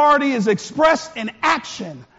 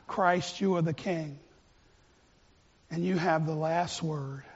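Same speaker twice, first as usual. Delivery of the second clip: quiet prayer; 7 semitones lower, 10 dB quieter than usual. Real, not acted. Closing prayer.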